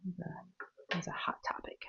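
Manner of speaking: hushed aside, voiceless; whisper. Real, not acted. Vlogger to viewers, whispering a secret. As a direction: drop to a whisper for the juicy topic.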